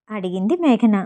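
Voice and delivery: ordinary delivery, nothing marked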